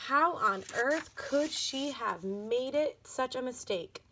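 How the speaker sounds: ordinary delivery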